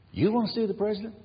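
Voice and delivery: put-on a small boy surprised and keen; eager little boy. Little boy offers to take the soldier, eager child.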